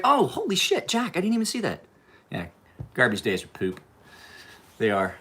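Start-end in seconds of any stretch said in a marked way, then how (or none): 0.0-1.8 high-pitched, louder startled outburst; real surprise. Real, not acted. Host to Jack on noticing something missed, startled high exclamation.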